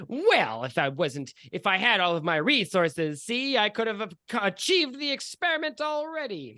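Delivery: high, loud, hammed-up character voice; overheard villain voice. A voice overheard by the players, high blustering character.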